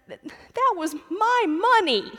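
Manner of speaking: put-on high, slow, loud sobbing child voice; sobbing toddler. Toddler sobbing to his father in the narthex, high and slow. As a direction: whimper it out like a robbed three-year-old. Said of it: "between sobs".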